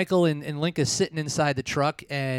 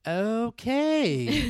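a higher, doubtful drawn-out okay; unimpressed okay; 8 semitones higher than usual. Retold or imitated. Waitress reacting to a fussy order, higher and doubtful. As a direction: play the waitress who can't believe this order. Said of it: "the lady kinda looks like".

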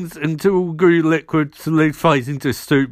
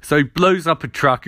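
ordinary talk, nothing marked

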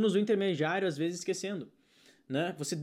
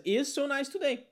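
higher, slow learner's phrase; mock learner; 8 semitones higher, slower than usual. Teacher imitates a student thinking in translation, higher. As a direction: pipe it up like a student. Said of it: "ele pensa".

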